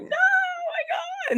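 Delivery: very high, slow crying wail; imitated child's anguished cry; sister crying out. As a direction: mimic a young girl's sobbing howl. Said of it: "Jimmy's sister's like".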